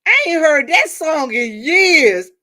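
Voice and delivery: high, loud, slow laughing quote; cracking up as you tell it; laughing retelling. Retold or imitated. To the live viewers about the old song, laughing. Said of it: "that said".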